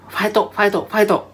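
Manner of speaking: acted supporter's cheer. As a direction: cheer them on with Japanese pronunciation. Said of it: "when cheering someone on".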